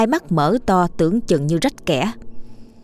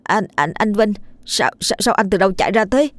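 stuttered, bewildered question; stammering, then a rushed question; startled stammer; 3 semitones higher than usual. Acted. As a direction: trip over each opening word. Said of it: "lắp bắp hỏi".

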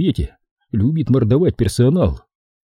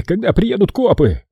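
high, sour boss finishing orders; bossy boss ending the order; 9 semitones higher than usual. Acted.

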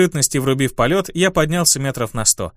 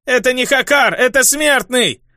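much higher, anguished groaning shout; groaning cry; 10 semitones higher than usual. A narrator's reading. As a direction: cry out in pain and fear. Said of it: "простонал".

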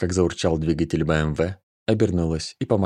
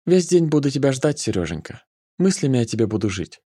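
put-on tender girl voice; 7 semitones higher than usual. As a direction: coo it, pitched high. Nika, high girl voice.

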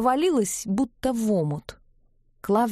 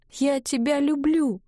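high, tearful I love you; sobbing confession; 6 semitones higher than usual. A narrator's reading. Ivga confesses love to Klavdiy, sobbing. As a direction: sob the words out. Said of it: "она всхлипнула".

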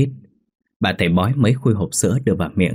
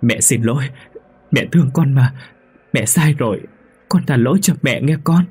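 tearful plea; 6 semitones higher, 3 dB louder than usual. Acted. Mother begging tearfully.

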